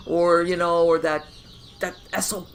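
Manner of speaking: ordinary delivery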